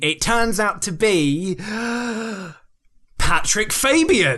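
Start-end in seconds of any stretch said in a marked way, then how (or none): 1.6-2.5 sharp, high mock gasp of shock; dramatic gasp. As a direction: gasp before the big reveal. Dramatic reveal of benefactor.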